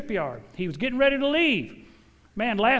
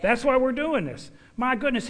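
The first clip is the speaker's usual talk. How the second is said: normal talking voice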